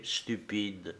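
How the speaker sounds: ordinary delivery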